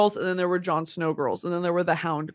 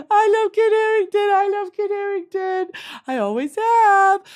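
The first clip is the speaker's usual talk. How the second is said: high, loud, drawn-out fangirl wail; gushing; 7 semitones higher, 6 dB louder, slower than usual. Real, not acted. Streamer declaring love for an actor, gushing wail. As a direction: swoon loudly, voice climbing into a squeal. Gushing over celebrity crush.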